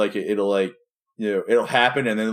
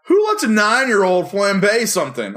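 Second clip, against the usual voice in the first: high, loud incredulous question; mock outrage; 9 semitones higher, 6 dB louder than usual. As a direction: blurt it in exaggerated disbelief. Retelling his outraged reaction to show.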